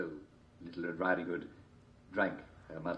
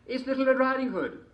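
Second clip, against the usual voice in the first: put-on girl voice; 7 dB louder than usual. A narrator's reading.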